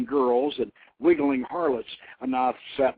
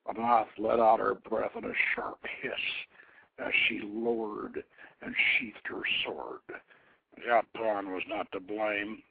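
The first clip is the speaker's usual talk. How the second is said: plain reading voice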